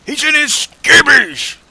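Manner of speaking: slow, high toothless bellow; screaming southern boss. Retold or imitated. Old boss repeats himself to a baffled crew, mock scream. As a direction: stretch it out in a garbled southern holler. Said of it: "he starts screaming into the radio".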